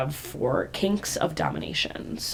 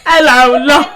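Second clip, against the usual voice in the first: a hint of a British accent, much louder, giggling; mock British accent; 8 semitones higher, 14 dB louder than usual. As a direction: say it like a cheeky Londoner. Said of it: "your British accent".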